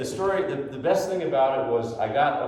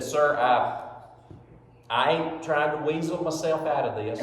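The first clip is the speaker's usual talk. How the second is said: lightly imitated Southern drawl, a little laughing; a hint of a Southern good-old-boy drawl; sheepish Southern driver, lightly voiced; Larry the Cable Guy drawl; 2 semitones higher than usual. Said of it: "and he talked like that".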